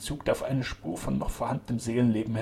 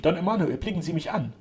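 raised, pleading address; urgent appeal; 7 semitones higher, 4 dB louder than usual. A narrator's reading. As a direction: implore a friend to recognize you. Duke appeals to sick friend.

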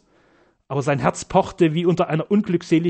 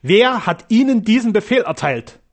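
indignant demand, higher-pitched; angry, haughty; 5 semitones higher, 4 dB louder than usual. A narrator's reading. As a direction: demand an answer with proud anger. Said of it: "Ihr Gesicht nahm einen zornigen Ausdruck an". (zornig und stolz gefragt)

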